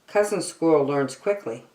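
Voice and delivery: plain reading voice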